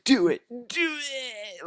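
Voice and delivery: high, drawn-out mock plea; mock begging to die. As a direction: imitate a desperate girl, stretching it out slowly. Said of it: "like, begging to die".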